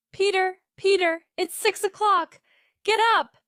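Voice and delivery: loud, high call to wake him; mother shouting her son awake; shouting up the stairs. A narrator's reading. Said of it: "she shouted up the stairs".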